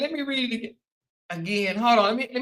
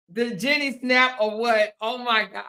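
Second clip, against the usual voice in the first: normal talking voice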